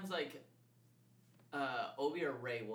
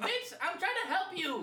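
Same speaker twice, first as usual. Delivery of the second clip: higher, louder laughing retort; exasperated retort; 6 dB louder than usual. Retold or imitated. Owner snapping back at the yelping cat. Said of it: "I'm like".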